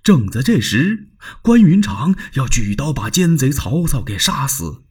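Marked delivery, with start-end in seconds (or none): none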